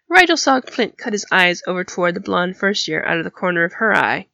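ordinary delivery

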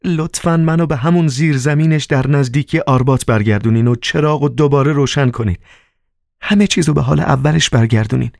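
high-pitched, sustained request; Margarita, pleading. Acted. Margarita begging Woland to send her home, high plea. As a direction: plead in a high, light character voice.

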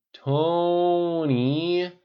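drawn-out, high, loud whine; whining. A narrator's reading. Pepper pestering Tony from the table, whining. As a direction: stretch the name into a pleading whine. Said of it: "Pepper whined from her perch".

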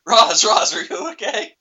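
worried call. Retold or imitated. Frasier rushes to sobbing Roz.

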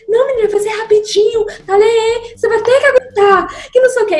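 high-pitched imitation of a brushing-off mom; impatient mother. Mother voiced, dismissing the plea. (imitação de mãe impaciente, voz aguda)

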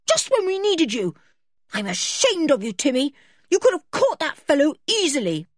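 high-pitched, furious scolding; shouting in rage. A narrator's reading. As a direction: tell the dog off angrily. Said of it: "She shouted at the surprised dog".

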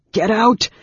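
loud, high, forceful outburst; panted order. A narrator's reading. Raistlin driving Anne Beryl away, loud and panting. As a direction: shout her away with failing breath. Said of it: "Raistlin panted".

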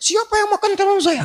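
very high-pitched acted scream; shriek. Wife discovers her spoiled eggplant, high shriek. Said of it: "dia teriak".

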